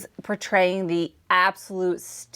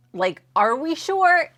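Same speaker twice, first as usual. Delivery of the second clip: incredulous; 8 semitones higher, slower than usual. Real, not acted. Doubting the claim.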